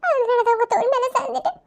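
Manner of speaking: sobbing between broken phrases; crying. Acted. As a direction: break down in tears, voice climbing as you apologise. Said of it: "இப்ப நான் அழணும்". (தேம்பி அழுதபடி)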